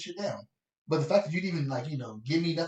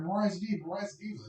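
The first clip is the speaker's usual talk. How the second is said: high, singsong mock of an assistant; mock nagging; 6 semitones higher, 3 dB quieter than usual. Assistant insisting on the star's demands, mock nagging. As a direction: mimic someone repeating the same excuse. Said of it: "she kept saying".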